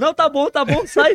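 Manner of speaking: high, loud, fed-up brush-off; breaking character. Retold or imitated. Drenched prankster telling the woman with water to stop, loud and fed up. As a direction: wave off the water, annoyed. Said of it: "eu saí do personagem".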